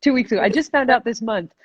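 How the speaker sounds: lightly acted urgency; mock urgency. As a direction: imitate someone newly alarmed and in a hurry to fix things.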